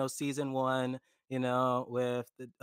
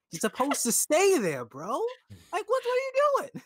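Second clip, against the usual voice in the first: high, incredulous scolding; exasperated viewer berating a character; exasperated disbelief. Retold or imitated. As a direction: climb high in comic outrage. Acts out exasperation at Lancel.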